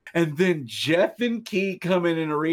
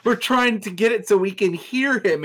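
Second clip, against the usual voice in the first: normal talking voice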